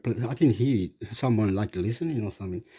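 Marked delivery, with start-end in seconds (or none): none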